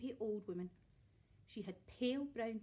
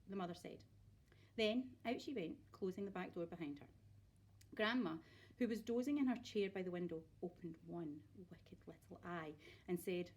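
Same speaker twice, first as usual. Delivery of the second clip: ordinary delivery, nothing marked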